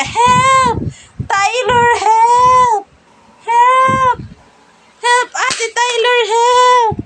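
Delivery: very high, loud mock cry for help; pretend cry for help. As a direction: squeal for big sister Tyler to rescue you, playing. Pretend cry for help, much higher. (napakataas at malakas na kunwaring iyak ng tulong)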